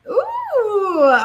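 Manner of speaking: ordinary delivery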